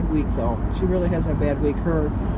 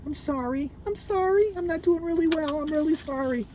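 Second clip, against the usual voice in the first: high, soft pleading voice; mock apology; 8 semitones higher, 4 dB quieter than usual. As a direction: whimper out a fake sorry, much higher. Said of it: "called Sorry September".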